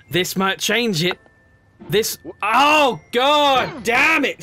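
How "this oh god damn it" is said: high, loud, slowed curse then insults; furious shout. Real, not acted. Player curses the pot man after a fall, furious shout.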